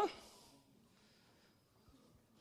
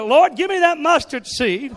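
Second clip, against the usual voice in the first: loud, high, full fervent cry; said like you mean it; 9 semitones higher, 12 dB louder than usual. Shown as a demonstration. Congregation urged to mean it, a loud fervent cry. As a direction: cry it out with total conviction. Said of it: "Say it like you mean it".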